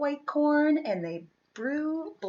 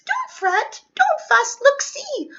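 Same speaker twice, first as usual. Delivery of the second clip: very high, squeaky soothing; squeaky mouse; 10 semitones higher, 6 dB louder than usual. Acted. Mouse squeaking to calm the crying bear, very high. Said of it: "Mouse squeaks".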